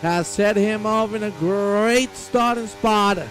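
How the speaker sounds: excited finish-line call; live race commentary. Acted. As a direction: race announcer building in pitch toward the finish.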